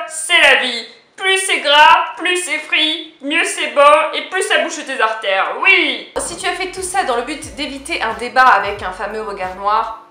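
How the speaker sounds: ordinary delivery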